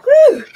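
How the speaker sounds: high, loud woo; loud cheer. Real, not acted. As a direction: whoop like a cheerleader. Cheer during workout.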